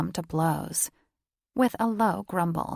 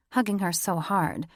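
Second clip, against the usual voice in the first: usual speaking voice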